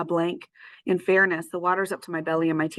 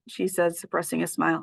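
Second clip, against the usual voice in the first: usual speaking voice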